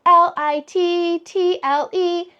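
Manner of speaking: letters spelled out slowly one by one; slow letter-by-letter spelling; spelling aloud. Shown as a demonstration. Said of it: "that's how you spell little".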